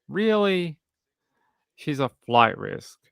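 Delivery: high disbelieving really; incredulous. Real, not acted. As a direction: scoff in disbelief. Incredulous at flight risk.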